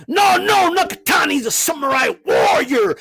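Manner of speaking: hammed-up know-it-all shouting him down; mock doubter shout. Doubters arguing against his pick.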